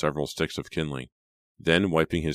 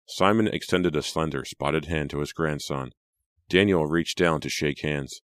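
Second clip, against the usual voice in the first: ordinary delivery, nothing marked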